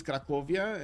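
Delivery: "Cracovia" as kra-KO-vi-a; Romanian pronunciation. Shown as a demonstration. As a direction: say "Cracovia" the Romanian way, ending in -via. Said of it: "the Romanian pronunciation for these ones".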